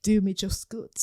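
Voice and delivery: hammed-up Geordie lad on the pull; mock Geordie accent. Host playing a Love Island Geordie lad in a mock accent. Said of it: "we have to do that accent".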